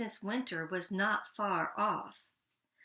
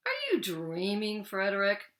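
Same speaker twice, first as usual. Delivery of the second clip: reproachful; 4 dB louder than usual. Acted. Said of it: "they asked reproachfully".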